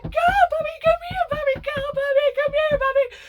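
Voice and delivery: very high, loud mixed-up calls; high panicked child calling a puppy; frantic puppy calls. Retold or imitated. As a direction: shriek at the puppy to move, pitched high.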